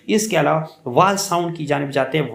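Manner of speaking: ordinary talk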